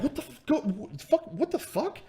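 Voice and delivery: high, stammering mock disbelief; acted-out flustered confusion; bewildered. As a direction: stumble over it in a small high voice. Acts out his bewilderment being led away.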